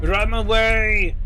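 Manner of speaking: loud, slow, drawn-out cry of retreat; mock panicked shout. Streamer fleeing the enemies, a loud slow hammed-up cry. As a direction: howl it like a cowardly knight fleeing.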